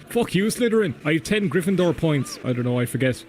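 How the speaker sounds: normal talking voice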